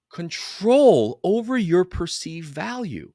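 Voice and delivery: slow, stressed control over your perceived value; slowed emphasis on control; slow emphatic repeat. Shown as a demonstration. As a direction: lean on control, slowly. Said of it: "I'm gonna repeat that".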